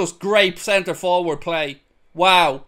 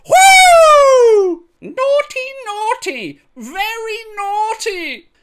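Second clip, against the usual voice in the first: high, loud wrestler's "Whoo!" then a drawn-out "naughty"; Ric Flair; 7 dB louder, much slower than usual. Retold or imitated. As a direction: ham up a Ric Flair strut, slow and high. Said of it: "as the great philosopher Ric Flair once said".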